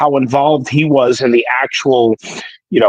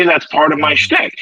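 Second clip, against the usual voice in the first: normal talking voice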